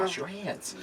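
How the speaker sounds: high, squeaky mock advice; silly high voice. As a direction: say it in a goofy high voice. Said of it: "Turned into a heavy metal song".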